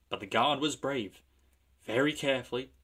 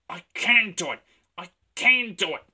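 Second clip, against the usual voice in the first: loud, high punch on CAN; puffing determination; 8 semitones higher, 9 dB louder than usual. A narrator's reading. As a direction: chug it out with heavy stress on CAN. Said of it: "he puffed".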